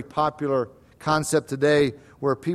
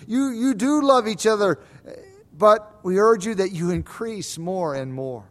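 normal talking voice

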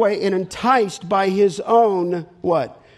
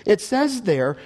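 usual speaking voice

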